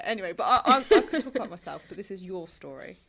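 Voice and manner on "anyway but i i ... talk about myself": louder, quick words through a laugh. Real, not acted. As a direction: laugh off the attention and rush on. Laughing off being praised.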